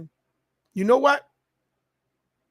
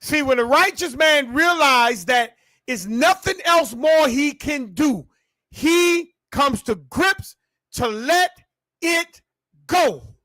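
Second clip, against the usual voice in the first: rising preacher build; 6 semitones higher, 4 dB louder, slower than usual. Acted.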